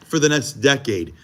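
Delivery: ordinary talk, nothing marked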